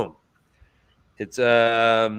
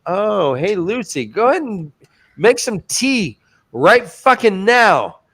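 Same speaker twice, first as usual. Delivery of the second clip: higher, falsely casual order; mock sly command; 6 semitones higher than usual. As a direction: hand out an urgent order as if casual. Acts out sly order to Lucy.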